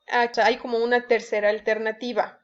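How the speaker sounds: ordinary delivery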